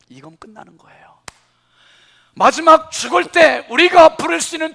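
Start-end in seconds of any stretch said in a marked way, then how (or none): none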